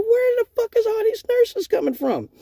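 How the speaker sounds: high incredulous cry; high disbelief. Retold or imitated. Stripper seeing nurses everywhere, high disbelieving squeak.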